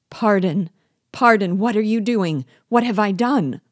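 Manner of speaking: high, loud cries of alarm; voice of terror. A narrator's reading. Victim to his would-be murderer, terrified. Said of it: "exclaimed in a voice of terror".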